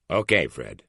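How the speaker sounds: with a big grin. A narrator's reading. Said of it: "says with a big grin".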